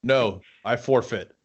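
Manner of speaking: ordinary talk, nothing marked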